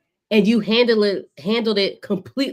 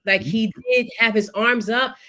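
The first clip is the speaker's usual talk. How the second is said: ordinary delivery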